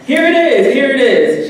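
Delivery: high, excited announcement; frantic grandmother. Retold or imitated. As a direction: imitate someone urgently showing off a find.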